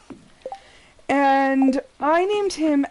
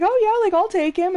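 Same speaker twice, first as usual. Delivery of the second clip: high, breezy retold offer; pitched-up, keen mock agreement; eager volunteering; 9 semitones higher, 3 dB louder than usual. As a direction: imitate someone jumping at an offer. Boss eagerly offers to take dog.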